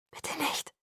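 faint whispered plea. A narrator's reading. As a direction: plead in a bare whisper. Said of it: "flüsterte ich". (flehend geflüstert)

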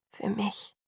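soft, hushed appeal; tender plea. A narrator's reading. Jacob asks Bella to live for him, hushed. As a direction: drop to a near murmur. Jacob pleads, nearly in tears.

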